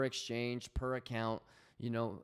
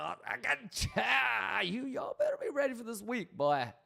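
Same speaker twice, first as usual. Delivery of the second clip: higher, louder, hyped-up yell to the chat; hype shout; 10 semitones higher, 6 dB louder than usual. Real, not acted. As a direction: fire up the viewers like a pep rally. Hyping viewers for the week.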